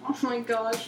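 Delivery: usual speaking voice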